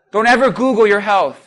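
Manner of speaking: ordinary delivery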